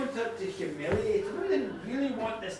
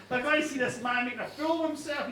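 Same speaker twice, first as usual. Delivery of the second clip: usual speaking voice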